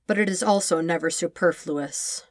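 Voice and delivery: plain reading voice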